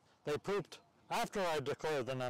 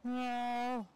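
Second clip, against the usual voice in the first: high meowing cat voice; 8 semitones higher than usual. Pretend. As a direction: meow like a cat. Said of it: "I do a really good cat voice".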